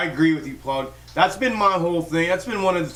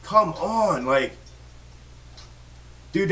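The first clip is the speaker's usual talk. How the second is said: normal talking voice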